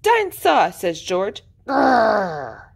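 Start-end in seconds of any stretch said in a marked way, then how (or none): 0.0-0.7 high-pitched, eager little piglet; thrilled small child's cry; excited exclamation. A narrator's reading. George names his favourite thing. 1.7-2.7 drawn-out playful growl; child's mock roar; dinosaur growl. A narrator's reading.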